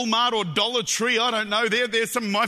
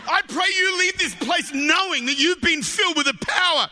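raised, higher-pitched preacher's voice; fervent preaching; 5 semitones higher than usual. Real, not acted. Preacher praying his congregation leaves filled, fervent preaching. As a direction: preach it out with mounting force. Preaches at rising full force.

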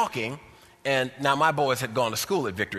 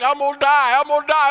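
mock panic; 11 dB louder than usual. Repeated panicked cry.

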